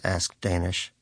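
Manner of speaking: usual speaking voice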